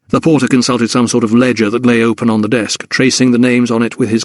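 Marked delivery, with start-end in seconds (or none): none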